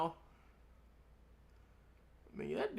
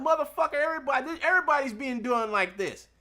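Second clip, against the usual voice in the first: heated retort; 7 semitones higher, 6 dB louder than usual. Retold or imitated. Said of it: "I'm like".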